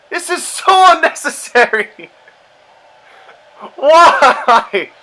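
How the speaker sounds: high, half-laughing despair; laughing exasperation. Real, not acted. Gamer laughing in disbelief at the game.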